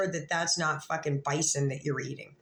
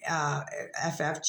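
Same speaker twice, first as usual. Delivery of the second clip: normal talking voice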